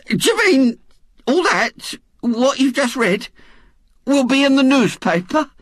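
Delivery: very high, incredulous woman's voice; astonished. A narrator's reading. Missus Bott asks the young man about the newspaper, astonished. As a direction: squeak it out in disbelief.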